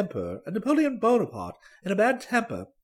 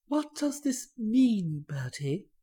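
soft and slow, voice wavering a little; quiet, shaky voice; 5 dB quieter, slower than usual. A narrator's reading. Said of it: "She spoke quietly, but her voice shook a bit".

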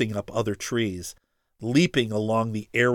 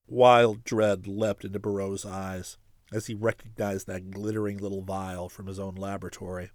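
ordinary delivery

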